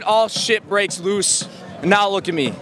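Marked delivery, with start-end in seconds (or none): 0.0-1.5 slow. Real, not acted. Retelling the collapse.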